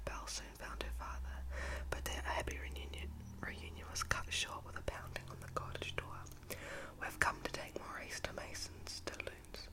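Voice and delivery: plain reading voice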